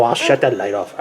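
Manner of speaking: ordinary talk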